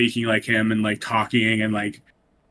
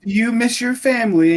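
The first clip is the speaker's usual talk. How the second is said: creepy high voice; 11 semitones higher, 4 dB louder than usual. Retold or imitated. Pinocchio scene quoted, creepy voice.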